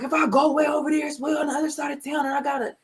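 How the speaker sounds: high whiny complaining son voice; mock whiny son. Son griping to mom about the long drive, high and whiny. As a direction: whine like a put-upon son. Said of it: "this is how I heard it".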